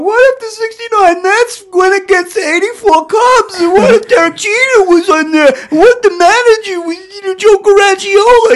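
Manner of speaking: put-on very high, loud Boston-accented rant; hammed-up Boston barfly, shouting over the room. Comedian mimicking bar guys' hypotheticals, frantic Boston accent. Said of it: "he's got this thick Boston accent".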